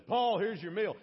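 loud guard calling out to a prisoner; Roman guard. Pretend. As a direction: call out loudly like a guard bringing food. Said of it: "A Roman guard comes and says".

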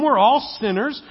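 ordinary talk, nothing marked

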